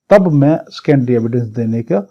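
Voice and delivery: ordinary talk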